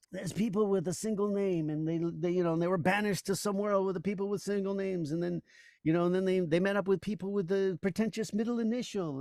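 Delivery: high, put-on comedian voice; Gilbert Gottfried. Comedian performing his bit about people's names, high character voice. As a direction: perform the bit in a sustained high-pitched impression. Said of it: "he did some bit about people".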